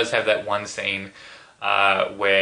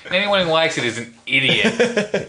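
high, loud mock outrage; mock rant; 8 semitones higher, 4 dB louder than usual. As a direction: ham up the insult.

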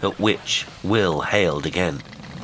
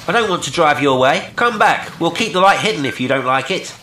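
high, loud, quick shout; urgent calling back; 10 semitones higher, 6 dB louder than usual. A narrator's reading. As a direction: call urgently after a departing boat. Will calls boat back.

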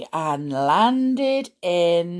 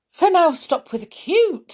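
higher and louder, telling herself off; mock stern self-command; 11 semitones higher, 6 dB louder than usual. Real, not acted. Scolding herself to stop the cuteness, mock stern. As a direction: snap yourself out of it, half playful. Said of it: "Stop with the cute, Sarah".

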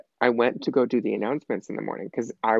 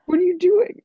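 high squeaky disbelief, louder; two kids arguing, Joe demands an answer; incredulous confrontation; 7 dB louder than usual. Retold or imitated. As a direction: demand it in shrill disbelief, pitched way up. Confronting the boy who took his turn.

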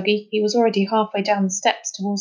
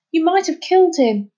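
high, loud reproach; reproachful; 8 semitones higher, 5 dB louder than usual. Acted. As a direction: lay the blame on him. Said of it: "said Dougie reproachfully".

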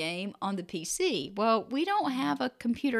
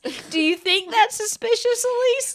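much higher, louder, sing-song; mock innocence; 9 semitones higher, 6 dB louder than usual. Mother to daughter, playing innocent. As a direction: play dumb, sweetly teasing.

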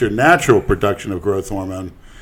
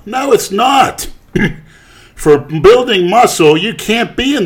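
emphatic denial; 11 semitones higher, 7 dB louder, slower than usual. Real, not acted. Contradicting the doctor's claim.

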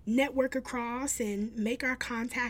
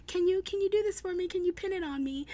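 higher, quick, mildly excited request; retold excitement; 7 semitones higher, faster than usual. Actor asking a fan to pin it on him, mildly excited. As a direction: imitate someone eagerly asking a favour. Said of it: "he just got really excited".

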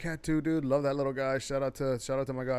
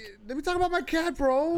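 indignant; 14 semitones higher, 3 dB louder than usual. Real, not acted. Cutting in to reclaim talk.

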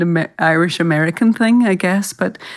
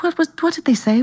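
higher, urgent pleading question; mock frantic disbelief; 10 semitones higher, faster than usual. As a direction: demand to know, half-hammed panic. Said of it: "I was like".

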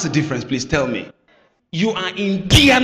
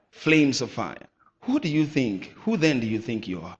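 usual speaking voice

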